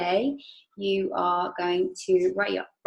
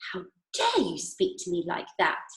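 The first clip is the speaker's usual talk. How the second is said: ordinary delivery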